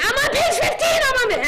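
put-on high, loud, laughing teen voice; breathless excited shouting; excited girl. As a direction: squeal it like a thrilled kid. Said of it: "I'd see her again".

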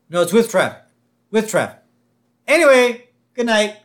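firm, hammed-up correction and brisk send-off; mock insistent cop. Police chief insisting on his traffic advice, loud mock certainty.

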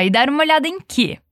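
normal talking voice

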